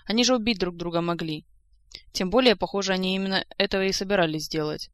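ordinary delivery

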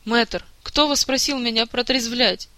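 slow, loud, indignant; aggrieved complaint. Acted. Jacques, sobered against his will, loudly grieving lost sleep. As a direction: protest bitterly at being sobered.

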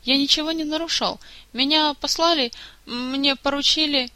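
high-pitched, plaintive self-defence; flustered excuse. A narrator's reading. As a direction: protest innocence haltingly. Flustered subordinate excusing himself to Kantor.

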